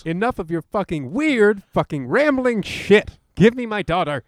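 angry hero. Acted. Hero demands his daughter back, loud hammy shout. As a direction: shout it like a cheesy action hero. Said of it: "you will be playing James Heller".

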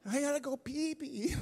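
put-on a child repeating her need, unbothered; little daughter. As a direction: daughter pleading again with her frantic father. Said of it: "my daughter comes up to me".

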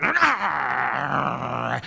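a short, loud bulldog snarl; dog growl. Acted. Spike growls harder at the old man on command. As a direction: growl like a dog warning a stranger. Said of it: "And Spike went".

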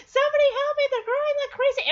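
high, fast cry for help; mock little-girl panic. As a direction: squeak it out like a scared toddler.

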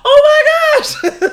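overjoyed oh my gosh through laughter; excited squeal. Retold or imitated. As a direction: squeal it with delight. Thrilled at meeting English speakers.

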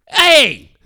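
sudden high, loud holler; imitated shout. Dad acting out the objection to a mom, sharp mock shout. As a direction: holler it to stop someone, as retold.

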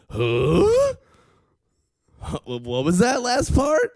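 high, rising mock confusion; baffled crowd. Trump supporters hearing about prison reform, baffled. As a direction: balk as if you misheard. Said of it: "And the Tim Allens are going".

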